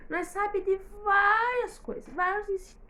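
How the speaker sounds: exaltada até a voz falhar; voz falhando. Acted. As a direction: insista com ênfase e deixe a voz falhar. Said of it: "até falha minha voz". (voz que falha de emoção)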